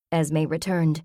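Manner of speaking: ordinary delivery, nothing marked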